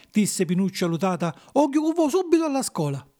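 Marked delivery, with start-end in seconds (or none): 1.5-3.1 put-on high-pitched eager puppet boy; small puppet's high voice; puppet child. Pinuccio promises to go to school.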